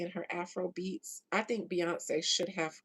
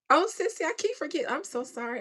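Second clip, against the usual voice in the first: high, loud burst of apology; flustered apology; 8 semitones higher, 7 dB louder, faster than usual. Real, not acted. Host apologising to a forgotten viewer, flustered. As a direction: gush the apology, pitched well up.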